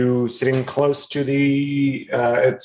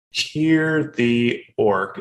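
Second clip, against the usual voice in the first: normal talking voice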